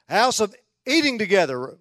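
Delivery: loud, high, playful declaration; mock proclamation. Preacher ribbing church about its meals, mock-grand proclamation. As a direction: call it out as a grand false scripture.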